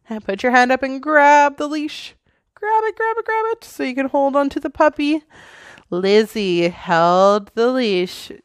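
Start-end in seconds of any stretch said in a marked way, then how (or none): 0.1-5.2 much higher, quick and eager; excited coaching. Real, not acted. Mother coaching child to grab leash.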